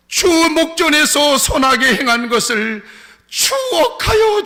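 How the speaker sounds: slow, loud plea climbing to a high cry; acted wailing prayer. Dying king begs God to remember him, wailing loudly. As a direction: plead slowly, building into drawn-out wails. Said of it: "엉엉 통곡하면서 울어요".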